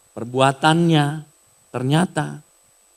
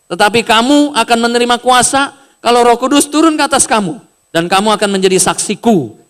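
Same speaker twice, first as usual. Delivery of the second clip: loud, higher, proclaiming verse; preached scripture; 8 semitones higher, 8 dB louder than usual. Acted. Preacher proclaims Acts verse to congregation, loud and forceful. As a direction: thunder the Bible verse to the congregation. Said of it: "ayat delapan berkata begini".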